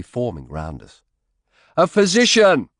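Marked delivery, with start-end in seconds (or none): 1.8-2.7 high, loud call for help; cried out. A narrator's reading. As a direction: shout for a physician across the crowd. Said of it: "I cried out".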